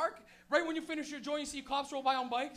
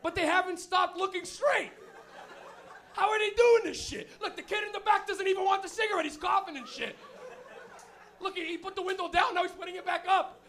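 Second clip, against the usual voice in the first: loud, raised mock disbelief; incredulous cop; 5 semitones higher, 9 dB louder than usual. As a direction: exclaim at the kids to your partner. Cop amazed watching kids, acted.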